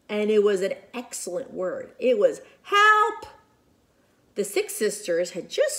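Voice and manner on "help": put-on high little baby yelp; baby's cry for help. Baby sister calling from the dragon's cave, high yelp.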